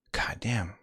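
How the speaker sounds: soft whispered swear; whispered curse. Real, not acted. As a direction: breathe it under your breath. Muttered to self.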